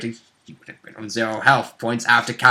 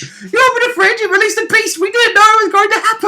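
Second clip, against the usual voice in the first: much higher squeaky mock outrage; 4 dB louder than usual. Teasing Rob for opening the fridge, very high mock outrage. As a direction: squeal it like a scandalised cartoon. Mocking friend who opened fridge.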